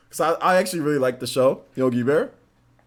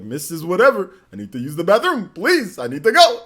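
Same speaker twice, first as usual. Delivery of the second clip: Yogi Bear; 4 semitones higher, 6 dB louder than usual. Acted. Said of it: "Talking like Yogi Bear".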